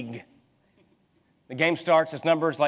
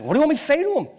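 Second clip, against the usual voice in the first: exasperated; 10 semitones higher, 3 dB louder, much faster than usual. Retold or imitated. Retelling reply to his wife.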